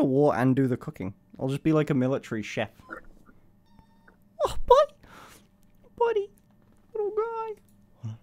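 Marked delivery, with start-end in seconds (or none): 4.4-8.1 squealing high with delight at the puppy. Real, not acted. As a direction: gush over the little dog. Excited at the puppy.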